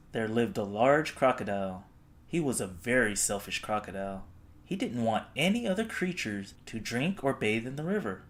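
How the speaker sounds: ordinary delivery, nothing marked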